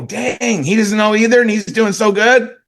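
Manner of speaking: high, amazed voice of an impressed listener. Retold or imitated. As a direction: act out someone impressed and relieved. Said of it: "Now they go".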